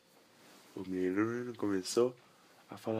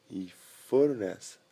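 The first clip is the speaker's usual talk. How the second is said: ordinary delivery, nothing marked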